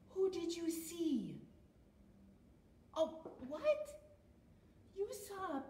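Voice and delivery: exaggerated storytime surprise at a puppet guest; mock surprise for children. Feigned surprise at penguin puppet's arrival.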